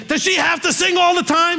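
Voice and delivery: high, put-on kid's complaining voice; whiny young son. Son complaining to his dad about his sister's singing, high whiny kid voice. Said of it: "my son said to me".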